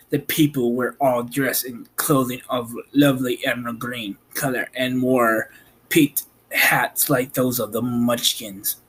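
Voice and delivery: plain reading voice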